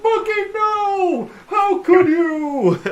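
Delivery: high, drawn-out mock grief; anguished yell. Player acts Darwin crying over the monkey, anguished yell. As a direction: wail it, slowing on the accusation. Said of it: "you were yelling about her monkey".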